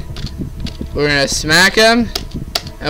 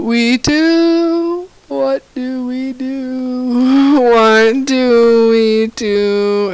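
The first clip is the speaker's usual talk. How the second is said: higher and very slow, stretched words; drawn-out despairing wail; 8 semitones higher, much slower than usual. Real, not acted. Player stuck in a level asks viewers what to do, slow high wail. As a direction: stretch every word out in helpless despair.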